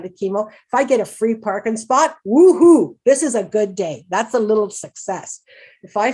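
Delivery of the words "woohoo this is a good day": woohoo cheer. Retold or imitated. Driver celebrating a free parking spot, loud happy whoop. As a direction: whoop at a small win.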